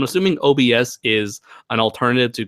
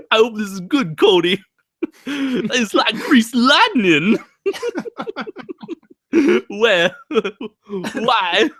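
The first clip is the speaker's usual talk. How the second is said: high, laughing Travolta voice; John Travolta impression; 10 semitones higher, slower than usual. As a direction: gush like Travolta, high and giggly. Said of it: "Ralph Garman's John Travolta impersonation".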